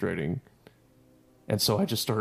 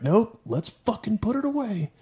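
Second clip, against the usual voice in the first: very high, sing-song false cheer; mock-cheerful; 12 semitones higher, 4 dB louder than usual. Fed-up soldier telling teammate to repack, mock-cheerful. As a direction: pitch it right up, falsely breezy. Acted reply while furiously repacking.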